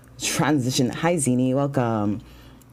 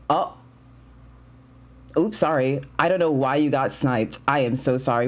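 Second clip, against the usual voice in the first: usual speaking voice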